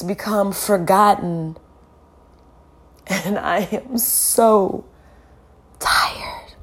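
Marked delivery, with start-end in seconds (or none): 3.1-6.5 exhausted whisper. Real, not acted. Worn-out speaker admitting fatigue to viewers, whispered. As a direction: breathe the word out, voiceless and spent. Confessing exhaustion after listing victims.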